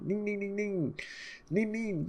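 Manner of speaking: very high sing-song ning chant; ninja voice. Acted. Raizo the ninja chirping before freeing Luffy, high sing-song. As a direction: play a goofy ninja's chirp.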